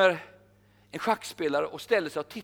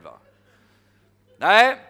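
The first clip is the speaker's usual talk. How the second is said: ordinary talk, nothing marked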